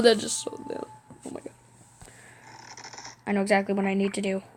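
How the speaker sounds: normal talking voice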